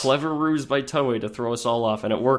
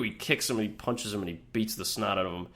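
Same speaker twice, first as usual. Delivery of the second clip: usual speaking voice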